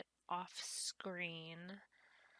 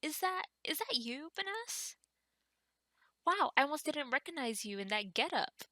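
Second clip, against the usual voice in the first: higher, lightly surprised Alice voice; Alice voiced; 6 semitones higher, 5 dB louder than usual. Villager Alice greeting the new farmer, higher and surprised. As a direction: read Alice with a raised, perky pitch. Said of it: "Alice says".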